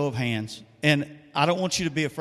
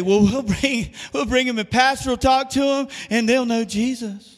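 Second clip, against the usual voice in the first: higher, lightly put-on voice, breezily passing the job on; mock churchgoer; 7 semitones higher than usual. Preacher mocks members who leave evangelism to pastor, higher and breezy. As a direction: imitate a complacent member, a touch higher. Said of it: "we say".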